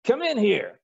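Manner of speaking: high, loud call through the door; barked summons. Retold or imitated. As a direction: summon the secretary sharply. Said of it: "Rickover says".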